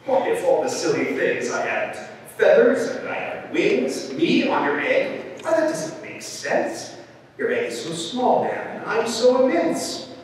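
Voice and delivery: incredulous protest, rising high; lightly amused, stretching the words; amused elephant. A narrator's reading. Elephant answers the bird's silly request, amused disbelief. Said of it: "The elephant laughed".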